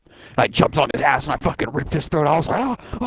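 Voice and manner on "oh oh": high, startled double oh. Retold or imitated. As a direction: gasp it out in shock. Reacting to killing in game.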